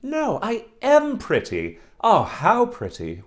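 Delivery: put-on a vain girl crying out in delight at her reflection; cries out delighted. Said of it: "she cried out".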